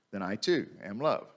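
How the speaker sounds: ordinary talk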